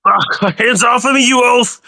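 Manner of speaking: high, loud affronted yelp; high indignant protest; indignant shout. Acted. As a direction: bark it out as the offended character. Character yanked back, protests.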